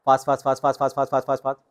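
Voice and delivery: Fast rattled off quick and clipped; fast drill. Shown as a demonstration. Coach demonstrates the fast drill for students. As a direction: fire off each fast rapidly. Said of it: "शुरुआत आप इससे करें".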